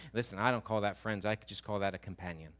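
normal talking voice

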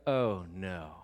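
low, deflated groan of dismay; dread. Acted. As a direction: sink down, drawn out, in mock dread. Acts dread, contrasted with bravado.

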